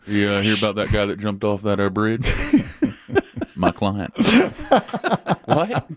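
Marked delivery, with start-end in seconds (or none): 2.4-3.5 sudden high laugh; dark-joke laugh. Real, not acted. Laughing about bridge story. 4.2-5.4 sudden high laugh; dark-joke laugh. Real, not acted. Laughing about bridge story.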